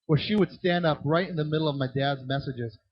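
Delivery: ordinary talk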